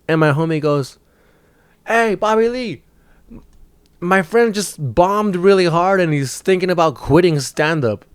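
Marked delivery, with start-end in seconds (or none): 1.9-8.0 higher-pitched call-out, words stretched; mock yelling out. Homie calls out to Bobby Lee, mock yelling out. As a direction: imitate a friend hailing someone across a patio. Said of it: "why are you yelling this out".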